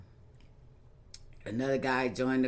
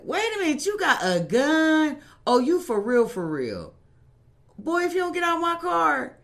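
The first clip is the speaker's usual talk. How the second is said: much higher and louder, incredulous; shocked outrage; 10 dB louder than usual. Retold or imitated. Facing a gun in her car, loud high disbelief. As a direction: scold him in loud disbelief. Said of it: "So I'm like".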